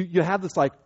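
ordinary talk

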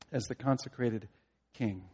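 ordinary talk, nothing marked

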